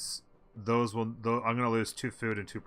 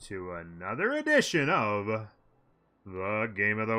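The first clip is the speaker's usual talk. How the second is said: announcer intro; 6 dB louder than usual. Acted. Streamer's show opening.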